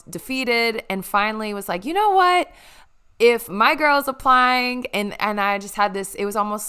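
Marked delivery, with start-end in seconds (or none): 1.8-4.9 higher, louder burst of determination; fired-up resolve. Retold or imitated. Friend retelling her decision to apply, fired-up resolve. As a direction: announce a sudden bold decision. Retold moment of new resolve.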